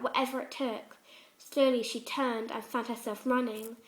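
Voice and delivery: plain reading voice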